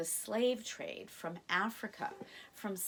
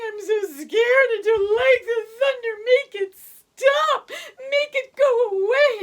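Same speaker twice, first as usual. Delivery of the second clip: high, loud wailing cub voice; crying baby tiger; 14 semitones higher, 13 dB louder than usual. Acted. Scared baby tiger to Cabri, loud high crying. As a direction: wail like a frightened little cub. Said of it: "Someone in the cave was crying".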